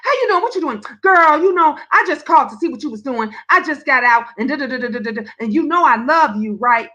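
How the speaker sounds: hurried phone chatter, skimming into da-da-da; sister's fast talk. Retold or imitated. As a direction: imitate a chatty sister rattling on. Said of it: "She talk real fast".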